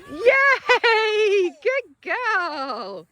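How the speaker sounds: high, loud, drawn-out cheer; excited praise. Real, not acted. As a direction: celebrate the dog's success. Praising a dog after recall.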